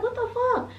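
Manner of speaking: high, squeaky shocked question; shocked disbelief. Retold or imitated. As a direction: let the pitch shoot up in disbelief. Shock at his dry reply.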